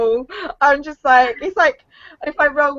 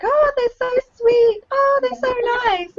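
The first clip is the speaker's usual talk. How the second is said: high-pitched mock cooing; put-on adoring squeal; gushing imitation; 12 semitones higher than usual. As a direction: coo it sweetly, much higher than usual. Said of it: "And I'm like".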